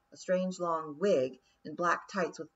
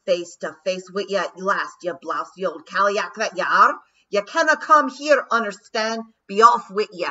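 loud, high Scots defiance; defiant Scottish pixie; 3 semitones higher, 12 dB louder than usual. A narrator's reading. Rob Anybody telling the Queen to leave, loud Scottish defiance. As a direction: bark Scottish insults at the Queen.

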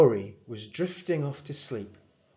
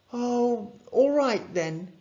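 higher, sighing agreement; reluctant lifted parent voice; relenting mum; 11 semitones higher, slower than usual. A narrator's reading. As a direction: give in to pleading kids. Mum gives in.

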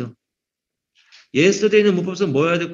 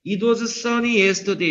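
slow, deliberate English read-aloud; slow example reading; 4 semitones higher, much slower than usual. Shown as a demonstration. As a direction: read it out slowly for the class. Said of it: "teacher reads example slowly".

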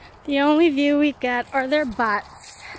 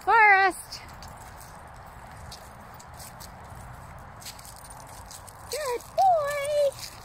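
high squeaky dog praise; squeaky praising a dog; 11 semitones higher, slower than usual. Real, not acted. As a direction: coo up high at the dogs.

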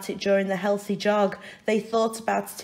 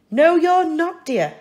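higher, louder firm refusal; firm mother; 10 semitones higher, 8 dB louder than usual. Acted. Mrs Large cheerfully overrules her husband, firm.

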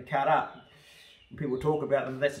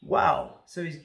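usual speaking voice

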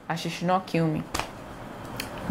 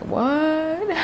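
mock disbelief; 10 semitones higher than usual. Teasing her audience about private school, mock astonished. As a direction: pitch shoots up on what, in put-on shock. Mock shock at no-phones rule.

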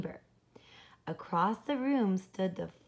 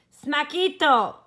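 loud, higher, slow smack; comic impact word; 7 semitones higher, 11 dB louder than usual. Acted. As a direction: land it like a big bang. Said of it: "sound-effect word of a crash".

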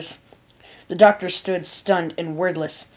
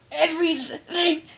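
weak, high, halting voice; struggling to speak through pain; pained complaint; 10 semitones higher, much slower than usual. A narrator's reading. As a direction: try to sound strong and fail. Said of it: "she complained, her voice trying to sound strong but failing".

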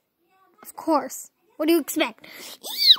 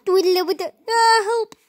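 panicked cry; 4 semitones higher, 5 dB louder than usual. Acted.

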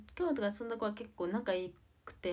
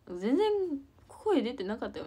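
louder, higher mock of mom's criticism; mother's complaint; 5 semitones higher, 8 dB louder than usual. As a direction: imitate mom telling you off. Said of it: "みたいな".

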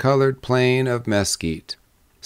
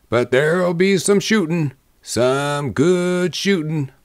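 higher character voice, grimly slowing at the end; grim answer; 6 semitones higher than usual. A narrator's reading. Man warning the drummer of shooting, grim. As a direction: draw out the last words with grim relish. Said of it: "answered one man grimly".

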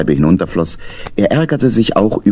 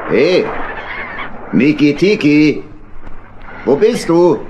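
higher voice calling across the boat; 7 semitones higher, slower than usual. Acted. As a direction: call out looking for the hedgehog. Calling for the hedgehog.

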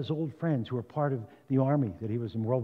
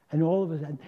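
ordinary talk

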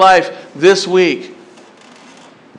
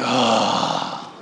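groaning dismay; 7 dB quieter, slower than usual. Retold or imitated. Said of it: "And be like".

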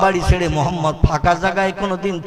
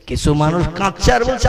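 ordinary talk

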